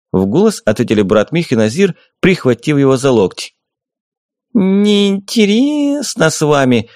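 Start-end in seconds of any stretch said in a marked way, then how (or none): 4.5-6.9 high, slow pouty fairy voice; bored fairy. A narrator's reading. The fairy pouts at the men before flying off, high and slow. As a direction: sulk like a bored little fairy.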